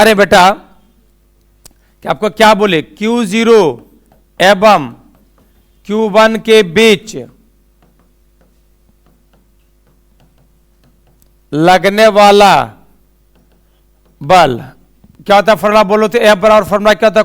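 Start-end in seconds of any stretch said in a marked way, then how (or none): 2.8-14.6 slow, word by word, with long pauses; slow dictation. Shown as a demonstration. Teacher dictating to students, slow and word by word. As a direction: spell it out slowly so students can write.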